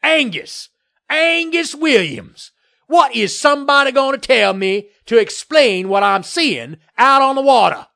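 high, loud blustering demand; angry hollering. A narrator's reading. Developer demanding answers from the mayor, angry hollering. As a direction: holler for an explanation, outraged.

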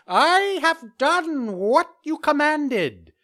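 very high, slow bird voice; falcon voice. Acted. Falcon reports back.

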